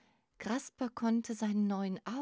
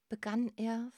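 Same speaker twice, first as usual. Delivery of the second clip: usual speaking voice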